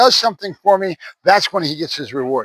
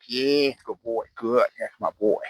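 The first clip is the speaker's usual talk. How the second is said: soft praise; 5 semitones lower, 9 dB quieter than usual. Real, not acted.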